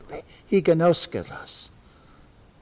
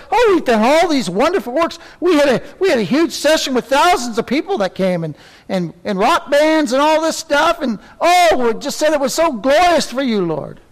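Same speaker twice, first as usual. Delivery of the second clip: much higher, louder smug bragging; mocking boasters; 8 semitones higher, 3 dB louder than usual. Preacher mocking churchgoers bragging of big glorious sessions. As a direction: ham up their self-praise.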